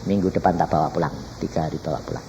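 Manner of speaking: lightly voiced God, low in pitch; low, measured divine instruction. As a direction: speak as God giving word, restrained. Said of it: "Tuhan ngomong sama saya".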